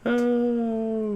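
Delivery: high put-on dog voice; a dog's sigh in voice. Owner voicing his dog, high put-on voice.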